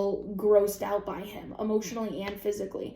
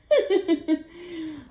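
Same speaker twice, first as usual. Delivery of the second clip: short high laugh; 8 semitones higher, 4 dB louder than usual. Real, not acted. Laughs at comment.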